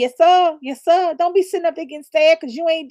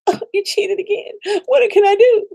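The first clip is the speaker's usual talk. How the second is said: mock pushover partner; 7 semitones higher than usual. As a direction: play the helpless partner who forgives. Acting out a forgiving partner.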